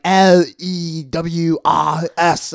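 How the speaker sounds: loud, very slow spelling in mock Walsall accent; annoyed Walsall accent. Local spelling a surname for the registrar, annoyed Walsall accent. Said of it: "they're, like, getting mad at me".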